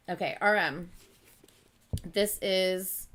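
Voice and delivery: ordinary talk, nothing marked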